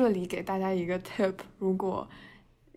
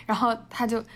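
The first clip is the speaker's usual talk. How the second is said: usual speaking voice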